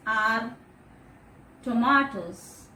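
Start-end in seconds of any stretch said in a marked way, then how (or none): 1.6-2.7 tomatoes slowed, "to-MAH-tos"; careful pronunciation. Shown as a demonstration. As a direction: model tomatoes slowly for class. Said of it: "Repeat after me".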